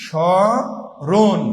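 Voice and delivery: স্মরণ stretched very slowly, every sound held; drawn-out pronunciation demo. Shown as a demonstration. Teacher to students, slowly sounding out স্মরণ. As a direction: spell out স্মরণ slowly for the class. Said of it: "স্মরণটা আমরা যদি লিখি".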